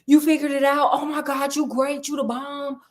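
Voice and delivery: a king overjoyed at the news; excited king. Retold or imitated. King praising Holmes, gushing excitement. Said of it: "he's like".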